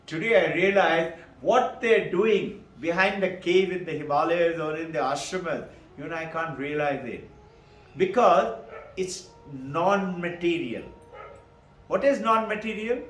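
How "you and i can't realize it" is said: normal talking voice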